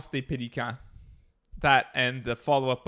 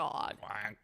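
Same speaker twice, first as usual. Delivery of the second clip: duck quack; 6 semitones lower, 12 dB quieter than usual. Pretend. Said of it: "that was a bad duck".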